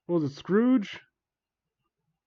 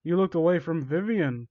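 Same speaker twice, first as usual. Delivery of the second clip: ordinary delivery, nothing marked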